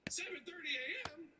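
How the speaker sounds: high, disbelieving question; can't believe how early; incredulous. Real, not acted. Outraged at early appointment time.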